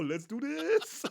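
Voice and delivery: high, slow, laughing and pumped; laughing battle cry. Acted. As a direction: drag it out through a laugh.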